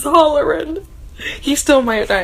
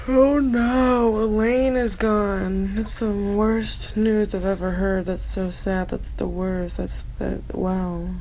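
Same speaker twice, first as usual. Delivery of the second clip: mock grief; 4 semitones lower, 6 dB quieter, slower than usual. Mock grief, fading quiet.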